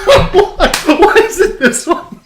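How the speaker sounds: very high, loud laughing plea; squealing laughter. Real, not acted. Cohost cracking up at the summary, squealing with laughter.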